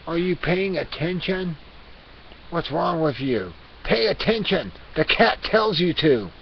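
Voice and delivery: building to a hammered-up telling-off; exasperated scolding. Pretend. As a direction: scold them like an indignant cat. Cat persona scolds inattentive viewers.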